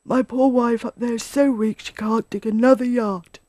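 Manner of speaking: lightly voiced, woeful animal; despairing Badger. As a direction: lament with a touch of despair.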